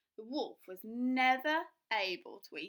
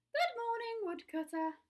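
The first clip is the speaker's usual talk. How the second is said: put-on high, louder little girl hello; cheerful greeting; 13 semitones higher, 4 dB louder than usual. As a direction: call out brightly.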